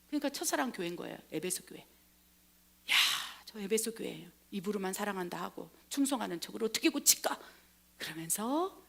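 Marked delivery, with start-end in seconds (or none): 1.8-7.4 낮고 작게 한숨처럼; 고민하듯 숨죽여 읊조리기; 탄식하는 혼잣말. Acted. 예수의 혼잣말 탄식을 연기. (나직이 탄식하듯)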